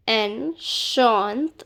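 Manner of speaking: Enchanté drawn out, ending on a rising té; slow careful demo. Shown as a demonstration. Teacher slows Enchanté so students hear each sound. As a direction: stretch Enchanté slowly for learners. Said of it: "This E is not pronounced".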